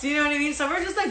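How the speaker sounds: ordinary talk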